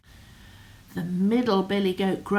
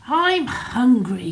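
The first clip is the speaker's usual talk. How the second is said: louder big goat call; loud announcement; 4 semitones higher, 8 dB louder than usual. Acted. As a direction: announce it proudly. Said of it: "he announced".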